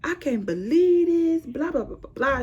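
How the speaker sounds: high whiny imitation of the other girl; mocking the upset girl. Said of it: "She was like".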